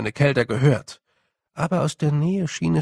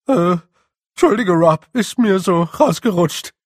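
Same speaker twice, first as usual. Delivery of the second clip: high, loud and flustered; squeaky sheepish apology; 6 semitones higher, 8 dB louder than usual. Acted. Dover apologises to Rob for saying the word again, squeaky and sheepish. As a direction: blurt the excuse, embarrassed.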